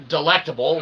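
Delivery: usual speaking voice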